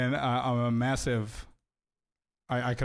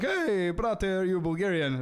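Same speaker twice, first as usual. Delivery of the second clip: higher, eager call to a fellow Balkan; excited greeting; 6 semitones higher, slower than usual. Retold or imitated. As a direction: greet him like a long-lost brother. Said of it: "I was like".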